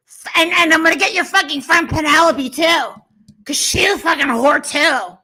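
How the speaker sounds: winding up into a hammed-up screaming fit; mock angry rant. Streamer rants at Justin for the chat, hammed-up fury. Staged rant, threats to sue.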